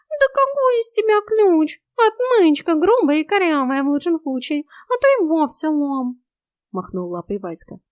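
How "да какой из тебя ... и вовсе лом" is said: high, loud cat voice; high-pitched, loud mockery; scornful cat. A narrator's reading. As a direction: belittle her as an indignant cat.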